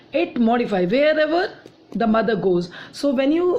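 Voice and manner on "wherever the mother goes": teacher leans on wherever to show what it modifies; stress on wherever. Shown as a demonstration. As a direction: hit the word wherever hard. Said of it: "stress on the word wherever".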